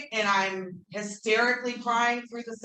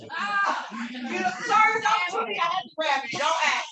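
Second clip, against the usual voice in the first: laughing apology; 12 semitones higher, 5 dB louder than usual. Real, not acted. Laughing, grabbing someone nearby.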